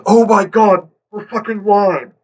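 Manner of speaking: loud, high exclamation; startled outburst. Real, not acted. Host exclaiming that they are live, loud outburst.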